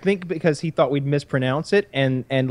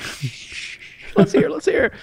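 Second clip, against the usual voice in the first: hushed shh, then eager; shushing; 14 semitones higher, slower than usual. Retold or imitated. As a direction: hush the buddies to listen. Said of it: "I'm like".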